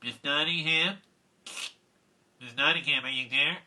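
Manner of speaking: school secretary on a speaker; PA announcement. Retold or imitated. Secretary calling the teacher over school PA, announcement voice. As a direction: imitate an intercom call, questioning. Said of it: "announcement came over the P A system".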